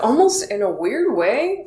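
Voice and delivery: ordinary talk, nothing marked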